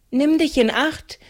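urgent warning chanted in a high voice. A narrator's reading. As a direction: insist on the charm, pushing harder. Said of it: "Stärker und eindringlicher fuhr Maren fort".

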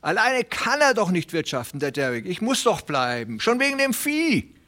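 high, hurried pleading voice of a small worried farmer; anxious little tenant. Acted. Hooven calls after Presley. (aufgeregter kleiner Pächter, hohe, drängende Stimme)

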